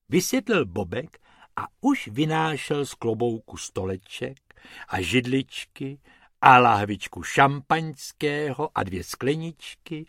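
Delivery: ordinary delivery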